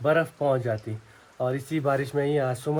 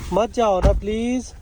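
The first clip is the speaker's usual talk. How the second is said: high, drawn-out mock begging; playfully whiny high-pitched plea; playful pleading; 9 semitones higher, slower than usual. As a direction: beg a child to stay, hammed-up. Playfully begging child not to leave.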